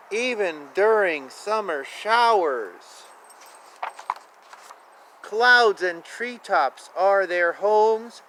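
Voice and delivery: gentle sing-song picture book read; lilting storybook narration; reading aloud. Acted. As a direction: read it like a bedtime rhyme for kids.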